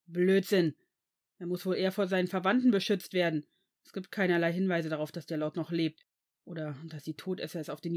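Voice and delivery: loud, heated rebuttal; angry outburst. A narrator's reading. Severus disputes the headmaster's protection claim, angry. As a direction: argue forcefully until cut off. Severus argues furiously, gets cut off.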